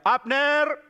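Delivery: calling out to Abner, pitched high; retold shout. From a hill calling the enemy's man Abner, high-pitched calling out. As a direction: imitate a shout from a hilltop. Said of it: "lalu teriak".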